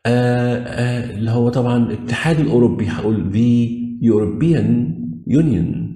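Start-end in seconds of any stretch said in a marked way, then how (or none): 3.3-5.9 slow, careful English; teacher modelling; slow demo reading. Shown as a demonstration. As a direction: lay out each word slowly. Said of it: "فلازم أقول the".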